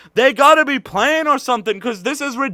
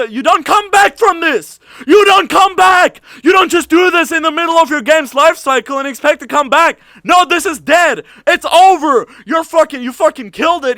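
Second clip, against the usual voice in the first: yelling in real fury at the developers, louder and higher; real angry shout; 5 semitones higher, 5 dB louder than usual. Real, not acted. Ranting streamer shouts furiously at the developers. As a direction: blow up, shout it at them. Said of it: "this is making me so angry".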